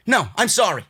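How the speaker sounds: ordinary talk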